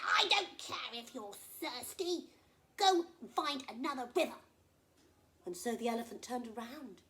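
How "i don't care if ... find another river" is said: gruff crocodile. Acted. Crocodile refusing the thirsty elephant, loud and dismissive. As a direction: brush him off coldly.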